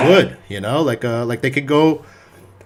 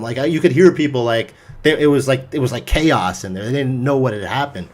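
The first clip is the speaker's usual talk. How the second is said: ordinary talk, nothing marked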